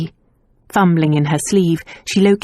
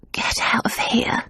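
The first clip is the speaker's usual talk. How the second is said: a sick maid's weak whisper, higher; 5 semitones higher, 6 dB quieter than usual. A narrator's reading. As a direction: barely breathe it out, worn out. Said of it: "Nan's weak voice said".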